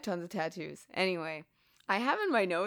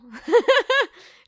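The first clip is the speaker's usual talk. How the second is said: high, loud burst of laughter; laughing at saying the actor's name; 12 dB louder than usual. Real, not acted. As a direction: crack up at your own slip. Laughing at own slip.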